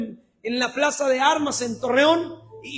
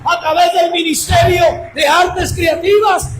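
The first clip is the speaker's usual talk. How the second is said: shouted sermon climbing higher and louder; preaching at full force; 5 semitones higher, 10 dB louder than usual. Real, not acted. Preacher urging the congregation into every ministry, full-force preaching. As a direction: build to a ringing, shouted climax.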